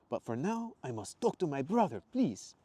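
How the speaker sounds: high, pleading Italian voice; earnest Italian-accented request. Acted. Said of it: "his faded Italian accent".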